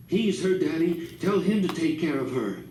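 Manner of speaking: lightly voiced drunken outburst; mild mock scream. A narrator's reading. Drunk father lashing out at his wife, mildly voiced angry. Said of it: "he suddenly screamed a man's name".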